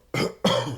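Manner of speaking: rough throat clear. Real, not acted. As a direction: clear your throat, sick. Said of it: "I keep clearing my throat".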